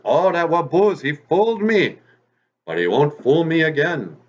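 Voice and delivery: high, loud storybook indignation; angry fox. A narrator's reading. Fox fooled by rabbit a second time, really angry. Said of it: "Wagosh is really angry".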